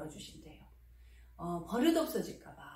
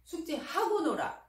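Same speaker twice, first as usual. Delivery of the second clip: bossy refusal; 5 semitones higher, 4 dB louder than usual. Shown as a demonstration. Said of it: "이러시면 아이가 말을 안 들어요".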